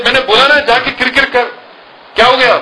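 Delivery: a fed-up father snapping; exasperated father. Retold or imitated. Father snaps at daughter still crying, high exasperated.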